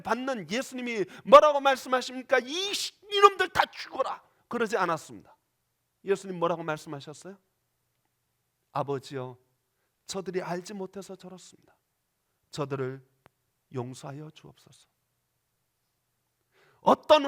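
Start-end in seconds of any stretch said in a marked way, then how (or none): 2.4-4.2 high, loud angry outburst; furious curse. Pretend. What Jesus did not say to mockers, furious cursing. 8.7-14.9 tender prayer. Acted. Jesus praying for his tormentors.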